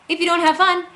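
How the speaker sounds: high, loud, excited voice. Acted. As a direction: pitch it up, bright and loud. Repeats the fun line twice.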